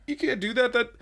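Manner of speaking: high-pitched imitation of a distraught boss; flipping out. Boss protesting over his broken model, mock flipping out. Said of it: "he flips out and he's like".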